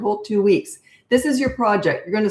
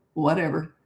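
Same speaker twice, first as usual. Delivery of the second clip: dismissive; 2 semitones lower, 4 dB quieter than usual. Shown as a demonstration. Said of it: "I just would have gone".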